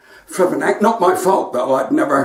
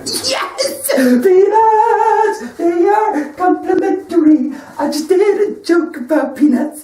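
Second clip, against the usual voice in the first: triumphant whoop; 13 semitones higher, slower than usual. Real, not acted. Comedian cheering his own peanut joke, high sung whoop. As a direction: crow in triumph over the peanut gag. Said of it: "celebrates his punchline".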